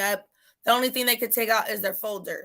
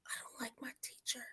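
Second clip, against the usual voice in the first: retold whisper; 2 semitones higher, 21 dB quieter than usual. Son confiding to counselor.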